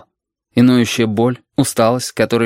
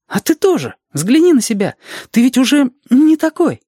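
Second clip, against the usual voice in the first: put-on high child voice with punchy emphasis; eager Reshka, insistent; 4 dB louder than usual. Reshka urging Alexander to look at himself, insistent child voice.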